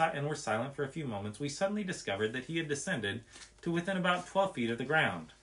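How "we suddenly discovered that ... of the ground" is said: ordinary delivery, nothing marked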